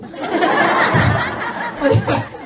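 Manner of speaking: airy unvoiced laugh at own joke; breathy laugh. Real, not acted. Comedian laughing at his own joke to the crowd, airy laugh. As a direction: let a breathy laugh escape.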